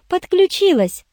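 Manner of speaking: gleeful high-pitched confirmation; joyful. A narrator's reading. Irene eagerly confirms she joined the case, joyfully. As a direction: chirp it happily, voice leaping up. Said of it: "радостно кивнула Ирен".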